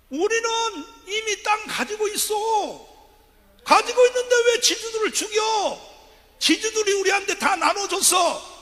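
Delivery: high, loud shout of outraged villagers; indignant Jeolla farmers. Retold or imitated. Speaker acting out Jeolla farmers answering the People's Army, shouting high. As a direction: yell it as a crowd of farmers defending their landlords. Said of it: "전라도 사람들이 뭐라고 말하냐면".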